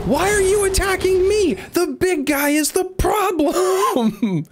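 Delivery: high falsetto outrage, stretched out; comic high-pitched tirade dissolving into a laugh; squeaky mock rant. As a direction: screech at the idiot allies in a cartoon voice. Yelling at game allies.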